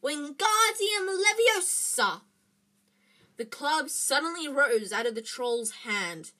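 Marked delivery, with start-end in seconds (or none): 0.0-2.2 high, loud, slow incantation; drawn-out shouted magic words; cried spell. A narrator's reading. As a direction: cry out the spell desperately. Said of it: "He heard himself cry the first spell".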